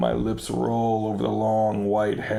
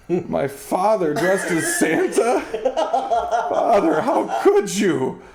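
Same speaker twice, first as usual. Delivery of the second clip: hysterical laugh; 9 semitones higher, 4 dB louder than usual. Acted. Unmasks father, hysterical.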